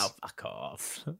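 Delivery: low, soft, drawn-out imitation of Mum; mum's brush-off. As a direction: imitate a mother's slow dismissal. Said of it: "she tells me to fuck off a lot".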